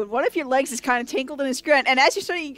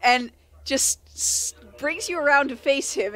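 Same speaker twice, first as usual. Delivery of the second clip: usual speaking voice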